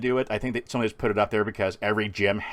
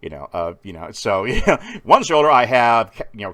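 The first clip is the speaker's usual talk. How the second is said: normal talking voice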